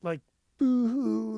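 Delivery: imitating someone bawling; mock sobbing. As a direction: ham up an ugly cry, drawn out. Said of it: "ugly crying".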